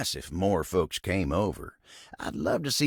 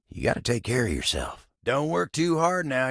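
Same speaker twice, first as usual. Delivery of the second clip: ordinary talk, nothing marked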